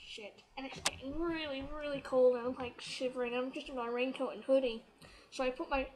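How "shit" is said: breathy whisper under the breath; whispered curse. Retold or imitated. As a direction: mutter it to yourself as rain hits. Rain starts while waiting.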